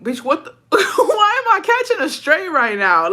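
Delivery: high, drawn-out squeal through laughter; mock-indignant, voice climbing into a laughing wail; laughing disbelief. Teller replaying her shock at a stray insult, laughing disbelief.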